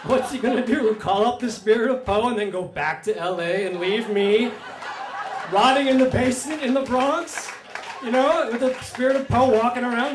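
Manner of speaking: loud, high, exasperated outburst; outraged rant. Real, not acted. Storyteller to audience, ranting loudly about the intruder. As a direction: shout it out, fed up and indignant.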